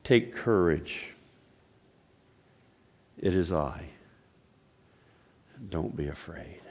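calm the frightened disciples; soft-voiced comfort, said as Jesus; gentle reassurance. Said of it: "immediately said to them".

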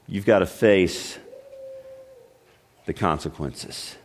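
a hushed, heavy pronouncement; grave verdict. Retold or imitated. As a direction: let the sentence sink low and quiet. God's verdict voiced again.

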